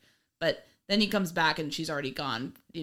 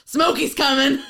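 higher, louder trucker radio call; 6 semitones higher, 9 dB louder than usual. Pretend. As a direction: warn about the cops like a CB trucker. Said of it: "they're all on the C B".

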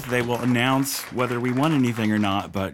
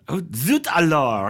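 put-on higher French-accented exclamation; French chef; 4 semitones higher than usual. Naming whose accent it was, French chef exclamation. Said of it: "It was the chef from".

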